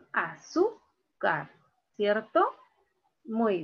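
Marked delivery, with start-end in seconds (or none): 0.1-1.5 "Azúcar" slowly split a-SÚ... car, weight on SÚ; stress on middle syllable. Shown as a demonstration. Teacher confirming the answer, "Azúcar" slowly broken as a-SÚ-car. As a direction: draw out SÚ in "Azúcar", then let car drop. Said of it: "Aquí está la mayor fuerza de voz".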